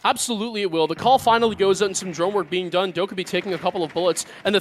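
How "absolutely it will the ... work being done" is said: usual speaking voice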